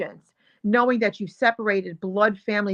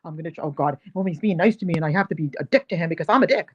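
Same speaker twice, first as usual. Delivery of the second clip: lightly voiced smug rookie, lower and quick; mock the rookie's grudging politeness, a touch lower; imagined inner voice; 4 semitones lower, faster than usual. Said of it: "that's the face I read".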